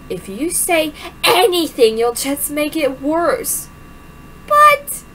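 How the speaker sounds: ordinary talk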